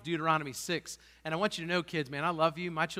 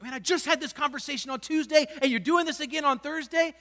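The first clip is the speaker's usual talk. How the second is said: raised, exasperated scolding; higher, louder fed-up parent; 7 semitones higher, 7 dB louder than usual. Retold or imitated. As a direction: scold the kid for doing it again. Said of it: "you think".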